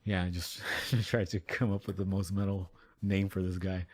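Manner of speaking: ordinary delivery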